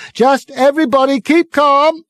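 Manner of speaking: high, loud fake-official calm; megaphone bawling. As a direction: reassure the crowd by yelling. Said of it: "bawling at the crowd through it".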